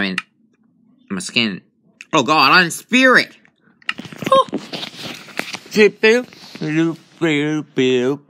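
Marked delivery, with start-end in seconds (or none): 4.5-7.7 imitated beeps. Mimicking phone beeps.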